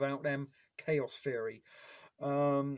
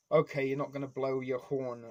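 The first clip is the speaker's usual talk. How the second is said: ordinary delivery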